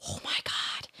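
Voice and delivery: hushed, breathless "oh my God"; amazed whisper. Retold or imitated. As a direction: whisper it in awe. Client's amazed reaction retold.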